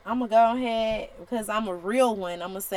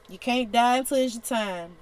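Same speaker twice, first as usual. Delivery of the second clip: ordinary delivery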